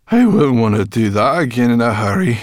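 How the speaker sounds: loud, high complaining groan. A narrator's reading. Sorrel groans after the flight, groaning complaint. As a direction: groan it out, worn and put out. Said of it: "she groaned".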